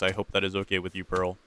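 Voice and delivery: ordinary talk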